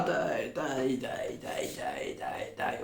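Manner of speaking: whispered daï daï to calm them; hushing whisper. Acted. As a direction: breathe the daï daï out softly. Hushing the children down. (chuchotement apaisant, daï daï)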